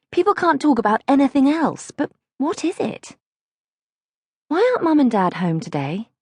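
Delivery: put-on a nearly-nine girl fretting over grown-up secrets; lightly voiced anxious child; worried little girl. Girl's anxious inner monologue.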